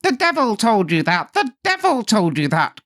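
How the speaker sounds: very high, loud raging imp; furious cry. A narrator's reading. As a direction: scream it in fury. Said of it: "cried the little man".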